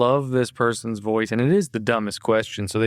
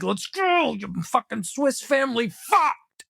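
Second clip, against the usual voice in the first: high mock road rage; 11 semitones higher than usual. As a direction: yell at the minivan ahead. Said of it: "and I'm like".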